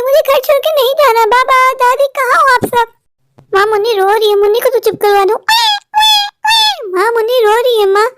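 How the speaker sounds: put-on a boy refusing to leave his home; upset child. Child protests leaving home.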